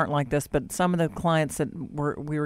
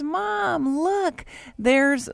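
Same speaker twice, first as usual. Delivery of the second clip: put-on high-pitched excited child; retold child's high voice; little daughter; 10 semitones higher, much slower than usual. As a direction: imitate a small girl calling to Mom. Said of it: "her daughter looks up, and she goes".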